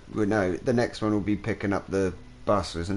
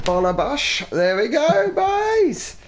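excited; 5 dB louder, slower than usual. Real, not acted. Plane landed, celebrating.

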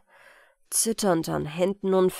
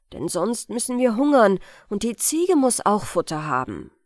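louder, impatient mother; a hard, dismissive mother; scolding mother; 4 dB louder, faster than usual. Acted. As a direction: brush off the girl's grief sharply.

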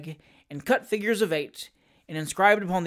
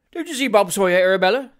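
loud, mildly chiding question; pointed brotherly nudge; somewhat reproachful; 6 dB louder, faster than usual. A narrator's reading. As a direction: prompt her with a touch of reproach. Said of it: "somewhat reproachfully".

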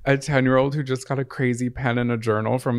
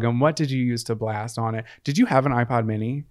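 normal talking voice

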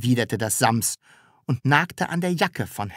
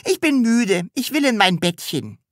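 high, whiny little voice; tired child's whine; 11 semitones higher than usual. A narrator's reading. Sams tired in Taschenbier's arms, whining. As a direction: whine for bed.

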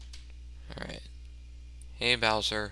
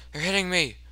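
higher, playful cry of alarm; mock panic; 7 semitones higher, faster than usual. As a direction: complain in hammed-up panic. Mock panic at being hit.

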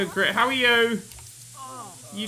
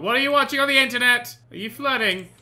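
raised, louder mock outrage; indignant confrontation; 5 semitones higher, 5 dB louder than usual. Player catching a Sim flirting, indignant. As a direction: call them out, pitched up and loud.

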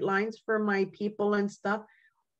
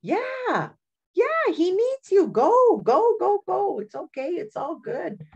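much higher and louder, shooing him out eagerly; eager encouragement; 13 semitones higher, 4 dB louder than usual. Retold or imitated. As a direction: push him out the door with hammed-up cheer, fading off at the end. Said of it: "And I'm like".

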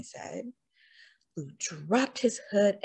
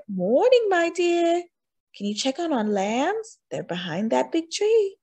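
much higher, friendly villager voice; higher sweet youth; 9 semitones higher than usual. A narrator's reading. Youth asking the wolf to mind lambs, higher and friendly. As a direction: greet him brightly.

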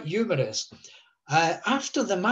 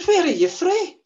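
Scots voice, pitched well up and louder; Scottish accent, raised; 14 semitones higher, 6 dB louder than usual. Retold or imitated. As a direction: put on a Scottish accent for the old man. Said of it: "Is that a Scottish accent?".

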